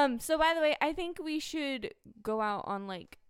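ordinary talk

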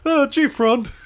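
very high cartoon sidekick voice; high-pitched goofy buddy voice; Bernie. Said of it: "And his friend Bernie".

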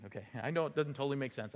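usual speaking voice

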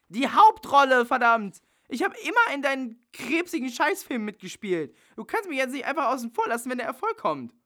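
indignant actress screaming for her part; furious yelling. Acted. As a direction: yell at him like you've been betrayed. Bella's tirade over the role.

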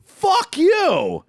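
high-pitched retold curse; exasperated, not truly mad, pitch jumping up. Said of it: "I wasn't mad".

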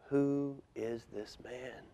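very soft, slow near-whisper; hushed question. A narrator's reading. Awed friends asking themselves about Jesus, hushed question. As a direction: breathe the question slowly, barely aloud. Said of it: "Jesus' friends were quiet".

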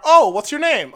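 mock cheerful clerk; chirpy clerk imitation. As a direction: play the overly sweet front desk girl. Rental clerk voiced in story.

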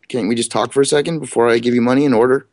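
ordinary delivery

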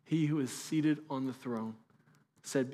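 a hushed slow proclamation; slow and soft. Shown as a demonstration. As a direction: let each word land slowly. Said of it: "repeated, slowed".

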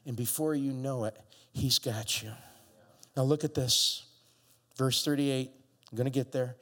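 ordinary talk, nothing marked

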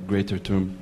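normal talking voice

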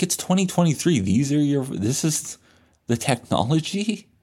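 high-pitched, disbelieving gripe; incredulous complaint. Retold or imitated. Patient baffled by outdated MRI technology, incredulous. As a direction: lift the pitch into a baffled question. Mocking the noisy old MRI machine.

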